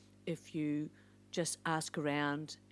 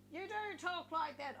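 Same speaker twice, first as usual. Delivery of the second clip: put-on old voice demo; 12 semitones higher, 7 dB quieter than usual. Shown as a demonstration. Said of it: "talking like this".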